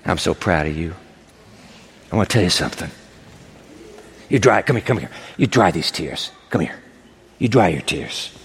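very low, fatherly reassurance; hushed-pitch, gentle coaxing; tender comfort. Acted. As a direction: console her in a deep, intimate voice. Jesus comforts the weeping woman.

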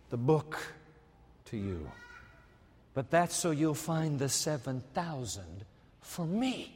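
usual speaking voice

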